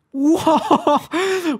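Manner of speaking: high, drawn-out whoa; amazed whoa. Real, not acted. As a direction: let out a stunned high whoa. Reacting to the missed chance.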